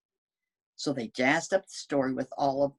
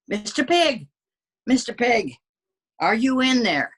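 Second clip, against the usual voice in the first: raised, louder call to the brick house; calling at the door; 8 semitones higher, 5 dB louder than usual. Acted. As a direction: call up at the door, a bit higher. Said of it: "I called".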